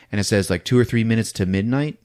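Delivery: normal talking voice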